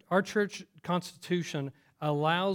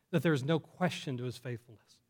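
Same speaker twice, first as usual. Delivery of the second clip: ordinary delivery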